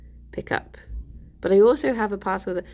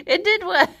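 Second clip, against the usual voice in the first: high, loud laughing relief; 11 semitones higher, 7 dB louder than usual. Real, not acted. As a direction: squeal it out through laughter. Relieved laugh after stream ends.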